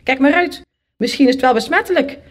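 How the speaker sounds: high, quick snapped warning; biting warning. A narrator's reading. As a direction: snap it at the child as the neighbour. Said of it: "beet ze mij toe". (vinnig toebijtend)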